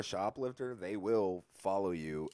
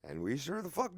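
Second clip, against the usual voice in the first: plain reading voice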